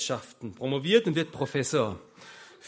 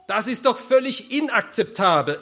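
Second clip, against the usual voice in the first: higher, louder voice of indignation; indignant outburst; 8 semitones higher, 9 dB louder than usual. Acted. Professor objecting to the middleman taking her profit, indignant. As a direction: protest it as an outraged professor. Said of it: "empört sich der Professor".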